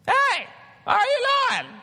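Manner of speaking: very high, loud squeaky shout; mock big-guy voice. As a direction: imitate a huge dude with a tiny squeaky voice. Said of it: "when he talks, he's like".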